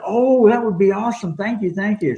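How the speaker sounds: lightly put-on thrilled woman; mock gushing woman. Retold woman thrilled to meet.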